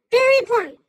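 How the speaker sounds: very important, punched out loud and high; loud emphasis. Real, not acted. Host hyping viewers before the reveal, loud emphatic call. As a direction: announce it like a big reveal.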